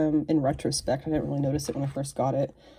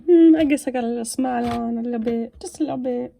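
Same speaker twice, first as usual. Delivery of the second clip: higher-pitched voice for a figure's face; voicing the standard face; 7 semitones higher, 8 dB louder, faster than usual. Host voices the figure's standard face, higher playful character voice. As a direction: speak as the barely smiling toy, pitched up. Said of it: "than just the standard".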